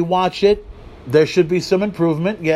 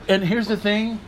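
ordinary delivery

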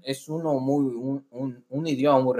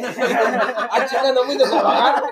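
mock scolding through laughter; 5 semitones higher, 3 dB louder, slower than usual. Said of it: "mock scolding quote".

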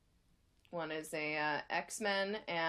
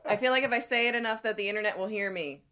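loud; 3 semitones higher, 8 dB louder than usual. Real, not acted. Shouting to the internet.

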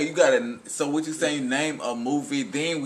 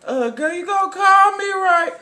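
way higher and louder, falsetto-style; mock flirting voice; 10 dB louder than usual. Quoting a movie woman, high squealed flirt.

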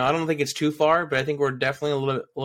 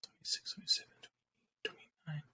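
counting under breath; 16 dB quieter than usual. Real, not acted. Counting episodes quietly to himself.

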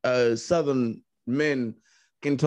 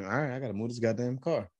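grumbling compliance; 8 dB quieter, faster than usual. Retold or imitated. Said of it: "I'm like".

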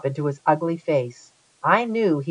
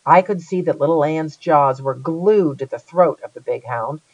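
usual speaking voice

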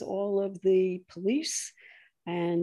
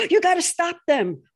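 higher, louder mock pleading; mock urgent plea; 7 semitones higher, 4 dB louder than usual. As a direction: beg urgently in a put-on voice. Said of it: "they go".

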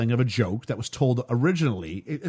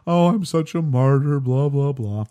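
mock martyr; 5 dB louder than usual.

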